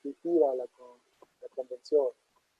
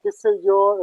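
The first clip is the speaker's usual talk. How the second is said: high, loud, mock-pompous grammar talk; hammed-up highbrow linguist tone; mock lofty scholar; 4 semitones higher, 7 dB louder, slower than usual. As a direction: parody an overly academic grammarian. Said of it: "no sean acá súper elevadas".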